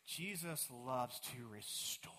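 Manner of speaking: slow hushed whisper; a soft, slow whisper of hope. Real, not acted. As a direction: lean in and whisper it slowly. Preacher drops to a whisper.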